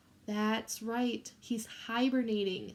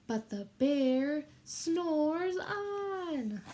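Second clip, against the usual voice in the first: very slow, drawn-out chant; slow sing-song refrain for children to repeat; 5 semitones higher, much slower than usual. Shown as a demonstration. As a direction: stretch every word so the kids can join in.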